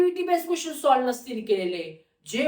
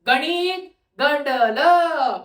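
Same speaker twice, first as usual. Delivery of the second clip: raised, drawn-out comic outburst; mock dramatic exclamation; 4 dB louder, much slower than usual. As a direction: stretch it out loudly as a comic verdict. Mock verdict on failed theory. (नाटकी, लांबवलेला मोठा उद्गार)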